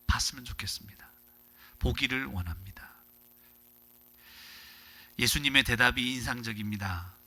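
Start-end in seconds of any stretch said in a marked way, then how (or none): 0.1-2.9 soft unvoiced whisper; whispered plea. Blind man whispers to Jesus that he wants to see, hushed whisper.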